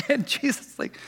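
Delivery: laughing through the words. Real, not acted. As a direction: break into a laugh mid-line.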